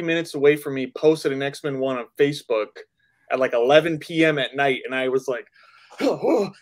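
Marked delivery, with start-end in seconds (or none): 6.0-6.5 breathless eagerness. Retold or imitated. Eager late-night message read out.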